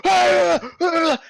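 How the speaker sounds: high loud panicked cries; distant giant screams. Acted. Giant running in flames past crowd, high screams. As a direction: scream from across the hall.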